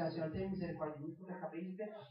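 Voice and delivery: soft, low, hurried confession; acted penitent prayer. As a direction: mumble a quick plea for mercy.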